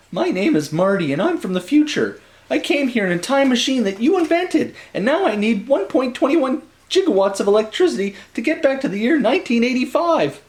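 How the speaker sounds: put-on teen Marty voice. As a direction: sell it as a kid begging for help.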